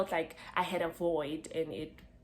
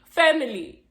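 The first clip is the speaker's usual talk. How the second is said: emphatic; 6 semitones higher, 11 dB louder than usual. Real, not acted. Emphatic exclamation.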